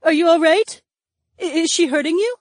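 very high, loud worried questions; anxious. Acted. Daisy worried Charles is being hurt, anxious.

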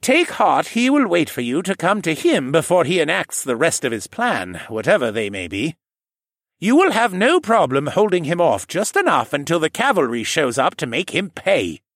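put-on old man voice. A narrator's reading. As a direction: reassure him like a kindly elder. Jenner reassures.

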